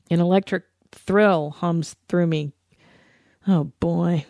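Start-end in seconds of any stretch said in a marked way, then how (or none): none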